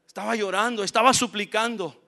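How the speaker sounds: ordinary talk, nothing marked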